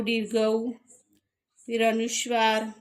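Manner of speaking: ordinary talk, nothing marked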